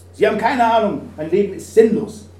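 hoch und laut, abweisend; stoß ihn verzweifelt weg; verzweifelter Mann. Acted. Verzweifelter Mann an der Klippe. (der Mann an der Klippe wehrt den Christen laut ab)

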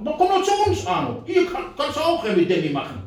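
high, wheedling recruiter voice; coaxing party man. Retold or imitated. Party members tempting the speaker, in a high coaxing voice. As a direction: lure him in, sing-song.

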